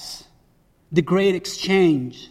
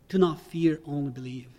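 soft repeat; 3 semitones lower, 8 dB quieter than usual. Shown as a demonstration. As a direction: quietly let the command sink in. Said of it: "repeats the command softly".

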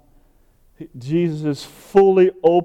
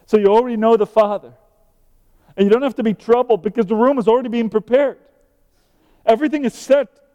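building from earnest to higher, louder preaching; rising sermon fervour; 7 semitones higher, 6 dB louder, faster than usual. Acted. Preacher assuring Thomas and congregation, building to fervent peak. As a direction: pile it on, climbing with each line.

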